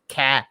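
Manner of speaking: "Ca" with the American a, as in care; American syllable. Shown as a demonstration. Host shows how you guys pronounce it, American "Ca". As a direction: say "Ca" with a fronted American vowel. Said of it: "you guys pronounce ca".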